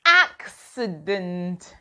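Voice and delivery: "Accident" drawn out slowly, AK-si-dent; slow model word. Shown as a demonstration. Teacher slowly modelling for the class, "Accident" with the k. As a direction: stretch "Accident" syllable by syllable. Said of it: "Look at my mouth".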